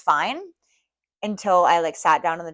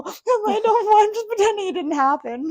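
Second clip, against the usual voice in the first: laughing through tears; 6 dB louder than usual. Real, not acted. Laugh-crying about the blood test.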